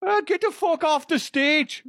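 high-pitched mock Irish brogue; hammed-up Irish fighter's holler; Conor McGregor. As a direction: heckle in a cartoonish Irish accent. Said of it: "like, Conor McGregor".

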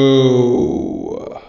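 booming drawn-out echoed whoa; big echoing announcer whoa; digital echo. Real, not acted. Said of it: "I've got an echo effect on my mic".

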